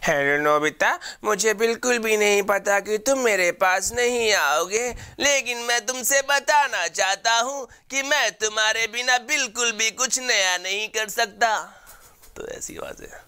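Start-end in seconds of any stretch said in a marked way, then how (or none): 0.0-11.6 high, slow cartoon robot cat; gentle, slow cartoon voice; Doraemon voice. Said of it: "जब Nobita परेशान हो जाता है".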